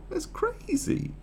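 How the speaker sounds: high, slow laughing "that's crazy"; incredulous laugh; laughing disbelief. Real, not acted. Laughing at the myth.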